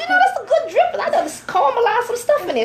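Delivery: high, laughing mock plea over the pan drippings; defending the pan drippings to the sous chef, high and laughing; laughing protest. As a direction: squeal it out, half laughing. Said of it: "I'm like".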